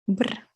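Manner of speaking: breathy shivering brr; soft shiver brr. Acted. Teacher mock shivering for the cold card. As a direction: shiver like you're freezing.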